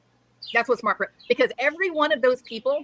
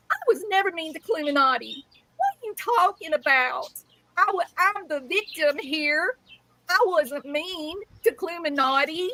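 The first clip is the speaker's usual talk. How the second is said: higher, whiny mock-victim voice; mocking Brittany; 4 semitones higher than usual. Mocking the ex-friend's whiny denial. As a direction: whine it like a wounded victim. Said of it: "I can hear it now".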